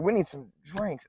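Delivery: imitating a drunk woman's demand. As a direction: slur it like a tipsy customer. Drunk customer demanding drinks again.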